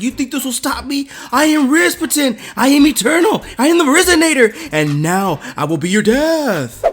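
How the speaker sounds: high, booming theatrical boasting; grandiose villain. Acted. Burning Rasputin threatens his attackers, grandiose villain cry.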